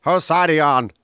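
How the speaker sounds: acted interrogation. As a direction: demand it like a TV prisoner. Reciting The Prisoner's opening exchange.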